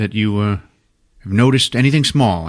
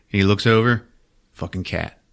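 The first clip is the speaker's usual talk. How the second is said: ordinary talk, nothing marked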